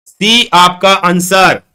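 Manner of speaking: very slow, stretching each word; drawn-out answer reveal. Real, not acted. Teacher revealing the answer to the class, drawn out. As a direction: announce it word by word, long and drawn out.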